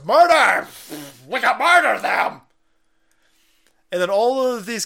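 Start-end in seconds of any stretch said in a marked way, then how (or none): 0.0-2.4 high, loud shriek of the word murder; mock shriek. Host mocks Jane Fonda calling for murder, high loud shriek. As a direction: shriek it like a cackling villain. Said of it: "And she goes".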